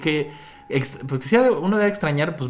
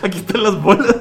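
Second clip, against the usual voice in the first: entre risas; 6 semitones higher, 6 dB louder than usual. Retold or imitated. Bulma responde, riendo.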